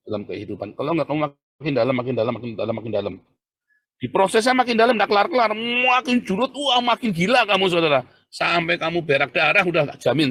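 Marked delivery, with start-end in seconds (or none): 4.0-10.3 high, loud preaching that eases toward the end; fiery warning. Real, not acted. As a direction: warn the congregation at full voice. Memperingatkan jemaat dengan suara meninggi. (peringatan khotbah yang berapi-api, suara tinggi)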